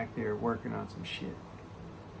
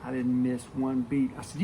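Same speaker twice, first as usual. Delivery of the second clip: ordinary delivery, nothing marked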